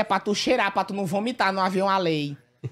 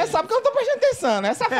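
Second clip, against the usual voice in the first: mock indignant; 13 semitones higher, faster than usual.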